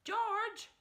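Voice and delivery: high, loud call; a father shouting for his son. Acted. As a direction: call the boy sharply. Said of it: "Mister Cranky shouted".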